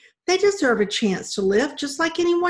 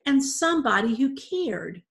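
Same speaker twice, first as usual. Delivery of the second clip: put-on higher, earnest young girl; rushing on earnestly; 5 semitones higher than usual. A narrator's reading. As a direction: explain with pleading insistence. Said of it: "Mandy rushed on".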